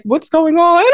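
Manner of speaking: high, puzzled whisper-like question; puzzled onlookers. Retold or imitated. Congregation watching her cry, asking high and puzzled. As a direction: ask it high and baffled. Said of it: "everybody's like".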